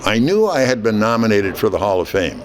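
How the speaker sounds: ordinary talk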